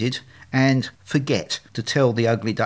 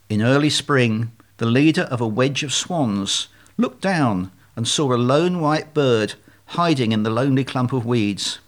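ordinary delivery, nothing marked

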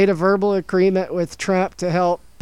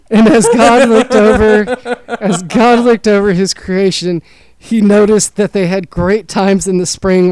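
loud, proclaimed Bible-story parody; grand mock creation tale; mock scripture; 6 dB louder than usual. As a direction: narrate it like Genesis, projecting.

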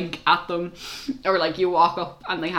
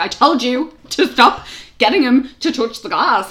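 higher, louder telling-off; exasperated scolding; 6 semitones higher, 6 dB louder than usual. Retold or imitated. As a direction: scold the parent in retelling. Retelling scolding of a parent.

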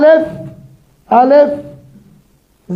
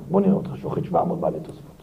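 fast, much softer and lower taunt; low scornful challenge; 6 semitones lower, 10 dB quieter, much faster than usual. Acted. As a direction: dare him under your breath, quickly. Challenging student, voice drops low.

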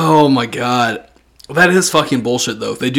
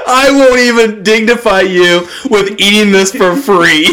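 mock defiance; 7 semitones higher, 4 dB louder than usual. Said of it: "voicing friend's defiance".

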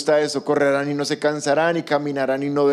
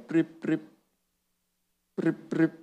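soft imitated beeps of a dying battery, softer; low battery beeping; 7 dB quieter, slower than usual. Preacher imitates tired people beeping like a dead phone, soft mock beeps. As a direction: mimic a phone's low battery chirp, quietly. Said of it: "empiezan a sentir que pitan".

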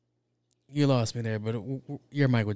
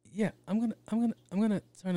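higher, faster insistence; 10 semitones higher, much faster than usual. Pretend. [name] repeating his robot idea to the puzzled executive, higher and quicker.

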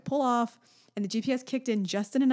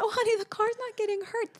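reassuring mom, laughing; 12 semitones higher than usual. Retold or imitated. Mom soothing scared child.

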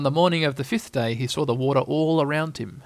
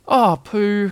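raised, higher cry for Pooh; distressed cry; 6 semitones higher, 5 dB louder than usual. A narrator's reading. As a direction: cry out as a worried storybook boy. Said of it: "cried Christopher Robin".

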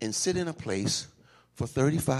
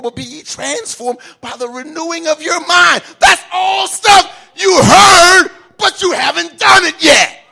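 loud, higher shouted preaching; preaching at full force; 14 semitones higher, 17 dB louder than usual. Acted. Preacher shouting at listeners who have not acted. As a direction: drive it home hard.

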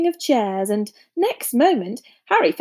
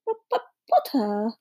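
nervous stutter on the P; timid, stuttering professor; stammering; 3 dB quieter than usual. A narrator's reading. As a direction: stammer haltingly into the name. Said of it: "stammered Professor Quirrell".

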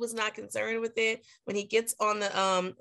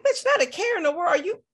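mock angry; 10 semitones higher, 3 dB louder than usual. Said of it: "She was so angry".

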